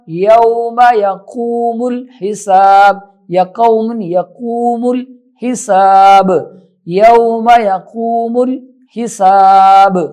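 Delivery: slow, measured tajweed recitation; Quranic recitation. Acted. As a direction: recite the verse carefully. Recites the closing verse. (تجوید کے ساتھ تلاوت)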